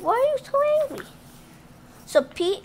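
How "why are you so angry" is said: much higher, very fast little bunny voice; bunnies wondering. Acted. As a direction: pipe it up fast like a tiny puzzled bunny. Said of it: "They're, like, wondering".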